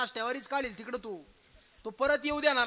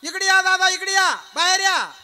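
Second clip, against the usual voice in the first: high, very loud summons; loud calling out; 8 semitones higher, 10 dB louder than usual. Acted. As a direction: holler to big brother to step outside. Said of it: "आवाज दिला".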